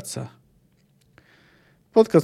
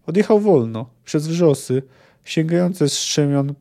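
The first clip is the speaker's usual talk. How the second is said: normal talking voice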